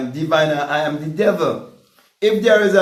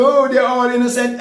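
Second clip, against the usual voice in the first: higher, louder mocking protest; a hammed-up, higher and louder protest of innocence; mock excuse; 5 semitones higher, 4 dB louder than usual. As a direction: parody a lame excuse.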